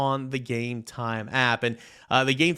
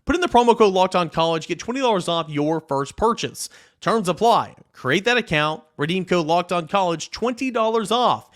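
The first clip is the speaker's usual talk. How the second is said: punchy ad read; 5 semitones higher, 4 dB louder than usual. Acted. Sponsor read of promo code.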